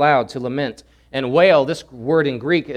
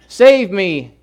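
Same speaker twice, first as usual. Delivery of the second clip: loud, raised plea; crying out to God; 5 semitones higher, 8 dB louder than usual. Retold or imitated. Drug user crying out to God, loud plea. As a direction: call out desperately for rescue. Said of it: "I cried out to God".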